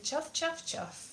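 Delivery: soft, fading train chuffs; chuffing engine noise. Acted. Comic voicing Ivor the Engine, fading chuffs. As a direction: puff along like a little engine. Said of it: "And Ivor the Engine went".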